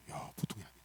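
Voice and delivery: quietly impressed, almost a whisper, 보통이 said softly; hushed wonder. Shown as a demonstration. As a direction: breathe 보통이 아니네 in awe. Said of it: "따라서".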